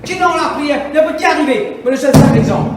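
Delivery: loud, high fervent sermon cry; shouting the call to open ears to God; full-force preaching. Acted. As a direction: preach it out to the whole church. Preacher exhorts congregation.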